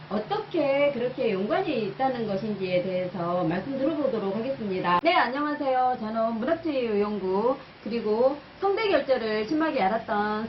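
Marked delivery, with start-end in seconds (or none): none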